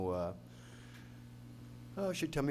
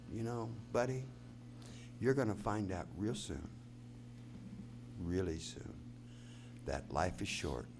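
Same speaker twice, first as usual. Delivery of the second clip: solemn soft advice; 8 semitones lower, 9 dB quieter than usual. Retold or imitated. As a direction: let it sink to a near whisper at the end. Grandma's solemn words before the song.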